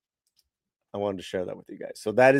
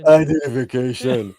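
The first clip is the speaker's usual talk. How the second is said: a hint of a flat robotic Terminator; Terminator impression; 2 semitones lower, 4 dB louder than usual. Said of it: "like the Terminator".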